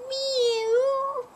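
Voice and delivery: very high, drawn-out mew; high little storybook kitten cry; kitten meow. A narrator's reading. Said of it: "she said".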